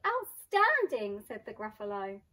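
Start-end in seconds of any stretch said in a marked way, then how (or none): 0.1-1.2 high, loud, slow wonder; drawn-out awe; amazed. Acted. Gruffalo impressed.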